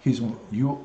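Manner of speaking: normal talking voice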